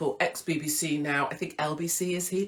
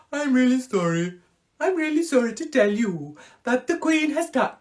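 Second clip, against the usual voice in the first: high, loud mock Punjabi accent newsreader; 7 semitones higher, 6 dB louder than usual. As Hugh Edwards breaking the Queen's death, a hammed-up Punjabi accent. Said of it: "That's a bit Punjabi".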